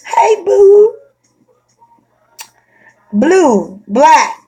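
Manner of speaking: high, loud catcalls; hammed-up catcalling; mock catcaller. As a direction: call out like a guy on the street.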